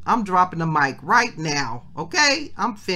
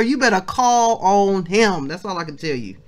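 ordinary talk

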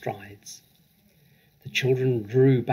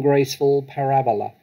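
ordinary delivery

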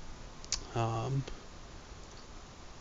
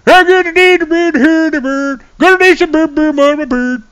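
much higher, louder Swedish Chef calling out enemy moves; Swedish Chef soldier; 8 dB louder than usual. Pretend. Imagining a Milsim stream, calling the flank as the Swedish Chef. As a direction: play a Muppet chef on a military radio. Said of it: "talk like the Swedish chef".

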